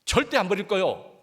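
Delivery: big, high, loud vow of loyalty; loud boast. Retold or imitated. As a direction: shout the promise like a proud disciple. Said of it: "큰 소리 쳤는데".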